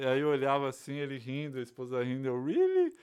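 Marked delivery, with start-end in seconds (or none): 2.4-2.9 very high-pitched put-on reaction; feigned amazement. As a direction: pretend to follow with an amazed squeal. Acting out his fake listening reaction. (espanto fingido, bem agudo)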